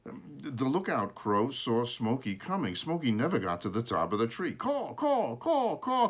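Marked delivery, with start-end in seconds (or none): 4.5-6.1 high caws, a warning cry; old crow's cry. A narrator's reading. Old lookout crow crying at Smokey, high warning caws. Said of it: "cried the old lookout crow".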